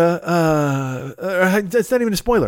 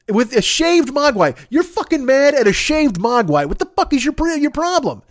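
high-pitched heated rant; worked-up exasperated tirade; rising rant; 8 semitones higher than usual. Real, not acted. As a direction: climb higher as the outrage builds. Escalating rant about outraged fans.

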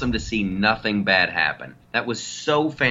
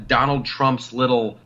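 ordinary delivery

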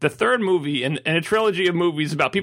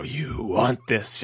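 very slow, lower, tempting hiss; sly villain tempting; 5 semitones lower, much slower than usual. Retold or imitated. Emperor tempts Luke with the lightsaber, slow and low. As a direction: dangle the bait, slowly. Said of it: "He's like".

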